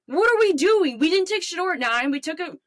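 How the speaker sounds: high-pitched, exasperated sports fan rant; upset caller. Acted. Fan calling sports radio about draft pick, angry rant. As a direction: rant at the radio host, incredulous and heated. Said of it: "upset about".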